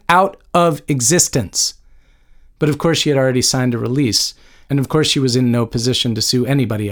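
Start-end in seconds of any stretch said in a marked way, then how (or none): 0.1-1.7 "Out of existence" slow and deliberate; "Out of existence" raised and stretched out as a threat; emphatic repetition. A narrator's reading. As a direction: hammer home "Out of existence", word by word. Emphatic repeat of legal threat.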